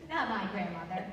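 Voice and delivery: usual speaking voice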